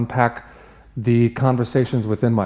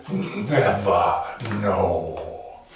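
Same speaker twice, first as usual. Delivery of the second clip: very slow, stretched words; drawn-out refrain; 4 semitones lower, much slower than usual. Acted. Man answers the village's sympathy, slow and drawn out. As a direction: stretch each word right out. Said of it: "He goes".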